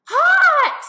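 mother imitating her child's shriek of pain; child's scream. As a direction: scream it like a burnt toddler. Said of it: "the next thing I hear".

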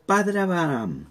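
clamor leve en tono más alto; grito leído, contenido. A narrator's reading. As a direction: lee la súplica elevando el tono, sin gritar. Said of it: "y gritó y dijo". (grito narrado, apenas insinuado)

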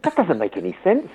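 puzzled complaint, rising in pitch midway; mock bafflement. Learner puzzling over English spelling, mildly exasperated. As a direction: act out a learner baffled by a word. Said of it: "look at a word and go".

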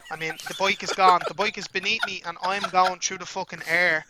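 ordinary talk, nothing marked

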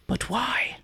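baffled editor. Retold or imitated. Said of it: "go kind of".

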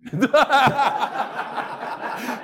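a big, high burst of laughter; hearty laugh. Real, not acted. Teasing the friend about trips.

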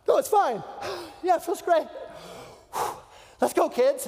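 high, loud, breathless false cheer; strained cheerfulness. Acted. Dad to his kids in cold water, falsely cheerful. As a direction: insist through the cold shock.

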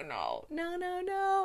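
mock despair. Dismay at a plot twist.

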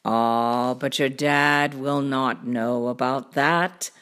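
low cartoon cat voice; deep show-off storybook cat; boastful cat. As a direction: explain it deep and unbothered. Cat reassures children.

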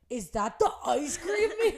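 high, loud re-enacted squeal breaking into laughter; excited mock yell. Couple hears music outside on the couch, excited mock yell. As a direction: yell it like an overexcited kid, laughing.

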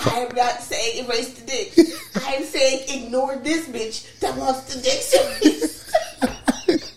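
slow, drawn-out words broken by laughter; laughing protest. Real, not acted. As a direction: insist through helpless laughter. Protests while cracking up.

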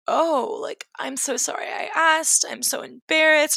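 lightly acted flustered, teary sorry; embarrassed, teary. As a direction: replay the moment of shame, a little tearful. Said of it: "I cried".

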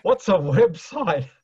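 imitating his Greek dad, baffled; mock Greek accent dad. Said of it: "and his great accent".

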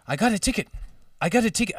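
high-pitched put-on African accent. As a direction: try out an African driver's accent. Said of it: "I'm gonna do the accent".

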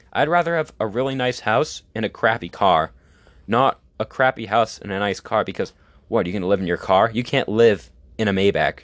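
ordinary talk, nothing marked

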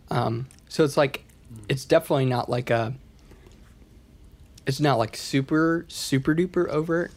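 ordinary talk

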